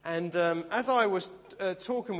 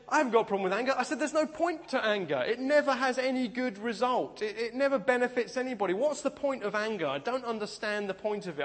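higher-pitched, insistent mock protest; protesting denial; 6 semitones higher than usual. Man retelling his denial to two elderly ladies, protesting in a higher voice. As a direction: act out defensively arguing your case.

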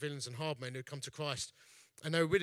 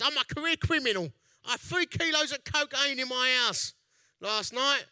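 higher, louder put-on voice of a young bloke, "career" leaned on; imitating Matt's voice; 8 semitones higher, 8 dB louder than usual. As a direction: do Matt the way he talks, louder and higher. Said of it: "He speaks like this".

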